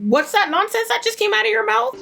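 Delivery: high-pitched, incredulous mock scolding; indignant high-voiced retort, acted out; mock indignation. Acts out outraged mother's retort.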